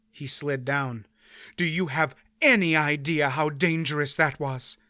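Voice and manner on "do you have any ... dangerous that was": plain reading voice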